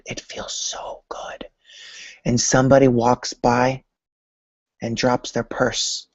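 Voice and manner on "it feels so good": breathy, slow, hushed delight; dreamy whisper. Pretend. Imagining wearing the jacket, speaking in a slow blissful whisper. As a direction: whisper it like you're savoring the feeling.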